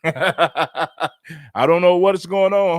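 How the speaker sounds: mock denial through laughter, drawn out slowly; laughing imitation. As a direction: imitate his excuse while still laughing. Laughs while acting Gunn's denial.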